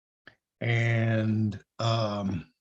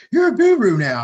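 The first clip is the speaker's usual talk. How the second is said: much higher and louder, mock-thrilled; teasing excited exclamation; 12 semitones higher, 10 dB louder than usual. Andrea teasing him about his success, loud and high. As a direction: hype him up like a teasing friend. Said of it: "She goes".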